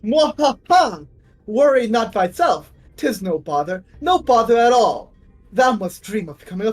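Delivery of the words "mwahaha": hearty villain laugh. Acted. Don Quixote hearty laugh.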